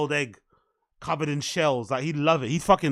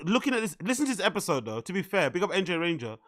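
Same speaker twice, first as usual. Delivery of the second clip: ordinary talk, nothing marked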